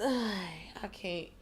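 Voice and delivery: breathy whispered give-up; sighing whisper. Real, not acted. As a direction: sigh and whisper it, done with it. Exhausted reaction after the story.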